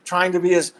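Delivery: ordinary delivery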